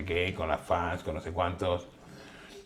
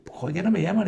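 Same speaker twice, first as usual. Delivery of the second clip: higher, whiny complaint; mock complaining star; 10 semitones higher, 4 dB louder than usual. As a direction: star grumbling nobody calls him, pitched up.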